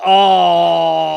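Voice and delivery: long, loud "oh" of disgust; drawn-out, very loud revolted groan. Real, not acted. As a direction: groan loudly and drag it right out. Disgusted by crude Trump remark.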